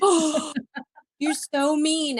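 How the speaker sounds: high, loud, slow pouting whine; mock whining. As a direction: pout it out like a sulky child. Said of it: "And I'm like".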